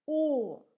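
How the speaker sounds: loud, clear O; teacher modelling the letter O, loud. Shown as a demonstration. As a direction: say the Russian letter O loud like in "sport". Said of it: "is pronounced like O".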